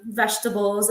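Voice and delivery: ordinary talk, nothing marked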